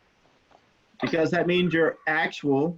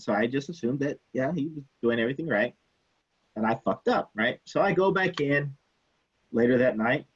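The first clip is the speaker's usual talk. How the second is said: ordinary talk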